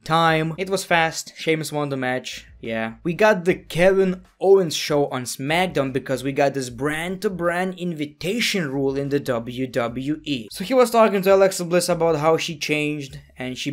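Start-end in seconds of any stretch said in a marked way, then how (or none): none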